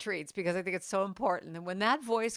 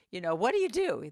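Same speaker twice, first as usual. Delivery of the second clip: ordinary delivery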